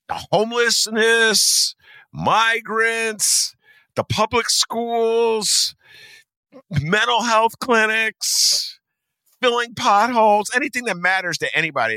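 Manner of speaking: high, drawn-out items; indignant listing. Real, not acted. Host listing causes that get refused money, high indignant drawl.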